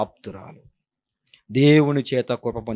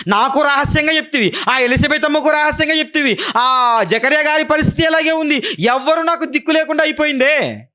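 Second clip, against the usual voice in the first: high, raised pleading cry to God; mock weeping lament; 12 semitones higher than usual. Mary crying out to God in distress, high and pleading. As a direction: lament like a desperate young mother. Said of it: "ఎన్నిసార్లు ఏడ్చింటది".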